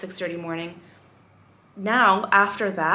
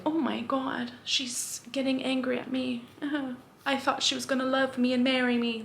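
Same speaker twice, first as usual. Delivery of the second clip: higher, softer mock-disappointed voice; mocking the catcaller; 5 semitones higher, 3 dB quieter than usual. Imagined catcaller dismayed that she is angry, mocking high soft voice. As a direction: play the wounded admirer, small and high. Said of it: "'cause he's probably like".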